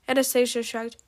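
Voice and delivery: ordinary delivery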